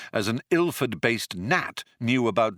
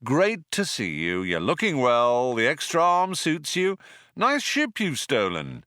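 cool drawl, lifted in pitch; drawled, unimpressed greeting; 6 semitones higher than usual. A narrator's reading. Ford greets Zaphod and his stolen ship, drawling. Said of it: "he drawled".

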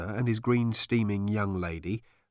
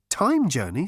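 lightly voiced, higher question; Trillian; 7 semitones higher, 4 dB louder than usual. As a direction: ask it back with pitch lifted. Trillian repeats it.

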